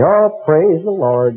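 slow, drawn-out "Oh, praise the Lord" in a syrupy mock; mocking a gushing televangelist fan; mock syrupy TV piety; mock gushing TV believer. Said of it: "these people making gurgling little sounds".

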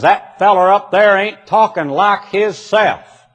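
lightly voiced Southern country man. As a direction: do the country boy talking. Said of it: "He says".

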